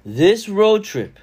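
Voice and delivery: loud, high, stretched out; excited build. Acted. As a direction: make it sound huge. Building hype over road trip.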